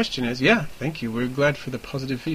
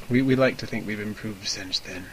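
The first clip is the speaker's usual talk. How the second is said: ordinary delivery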